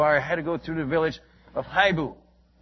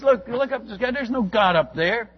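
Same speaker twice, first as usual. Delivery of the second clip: high, imitated dismissive jeer; scoffing; 6 semitones higher, 3 dB louder than usual. Membu scoffs at believers about God in the sky, scornful. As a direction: act out a scornful unbeliever, pitched up. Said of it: "he would scoff".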